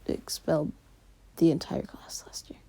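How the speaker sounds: dropped to a soft, breathless whisper; nervous whisper. Retold or imitated. Izuku whispering dread about the strict teacher. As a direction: hush it like you're scared he'll hear.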